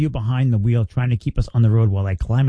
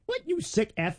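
mock offended reply; 11 semitones higher, 8 dB quieter than usual. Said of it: "you can't do anything to be like".

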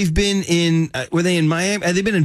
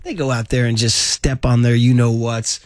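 normal talking voice

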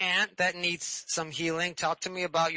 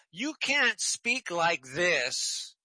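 ordinary talk, nothing marked

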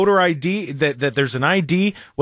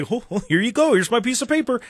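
high-pitched giddy mock senator; 6 semitones higher than usual. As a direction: put on a silly, gleeful higher voice to ridicule them. Mocking acted senators at play.

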